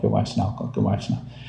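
whispered, dying away to nothing; fading whisper. Retold or imitated. Voice in his head repeating it, fading to a whisper. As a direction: let the inner voice fade into a whisper. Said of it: "a little voice in the head said".

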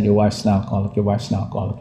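soft, low inner voice; a little voice in the head. Retold or imitated. Voice in his head telling him, softly and low. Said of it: "a little voice in the head said".

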